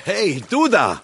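loud call across water. Acted. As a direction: shout to get his attention. Calling out to man at sea.

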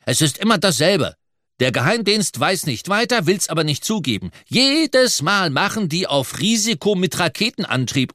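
high-pitched indignant rant; fuming. A narrator's reading. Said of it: "Nudel dampfte vor Wut". (wütend schimpfend, mit hoher Stimme)

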